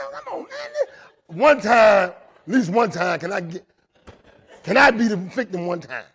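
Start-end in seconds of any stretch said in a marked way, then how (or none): 0.1-1.4 much higher, slow, laughing whine; laughing protest. Retold or imitated. Exasperated laughing plea.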